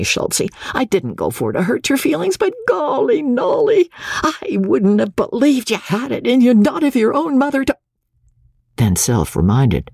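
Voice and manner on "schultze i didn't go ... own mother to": high, gleeful cheering, hardly able to sit still; frenzied excitement. A narrator's reading. Captain cheering the new actress at rehearsal, excited. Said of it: "in a frenzy of excitement".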